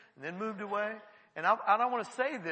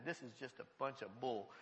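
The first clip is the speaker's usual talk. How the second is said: low, soft grumble; dismissive mutter; 9 semitones lower, 12 dB quieter than usual. Acted. Man sitting down watching it all, dismissive mutter. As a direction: grumble it under the breath. First quote of the sceptical onlooker.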